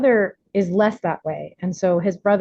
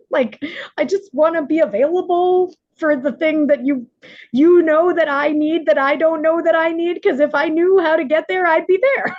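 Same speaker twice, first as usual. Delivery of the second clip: pleading to God; 8 semitones higher, 3 dB louder than usual. Retold or imitated.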